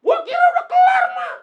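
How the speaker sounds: very high, loud panicked screech; shrill shouted protest; screaming eaglet. Acted. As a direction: scream up to mother at the top of the pitch. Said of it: "Anaknya teriak lagi".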